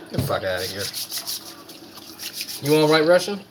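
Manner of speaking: normal talking voice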